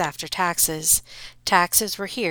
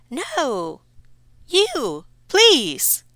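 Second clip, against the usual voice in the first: much higher, louder squealing refusal; panicky mother; 8 semitones higher, 7 dB louder, much slower than usual. Acted. Mother refuses to face the Count, shrieking. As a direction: shriek it back at him.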